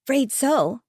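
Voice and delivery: croaky after coughing. Acted. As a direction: croak it out hoarsely. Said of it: "I croaked".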